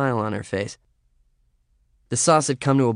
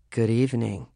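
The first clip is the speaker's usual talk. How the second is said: sexy voice. Acted. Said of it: "came the sexy voice".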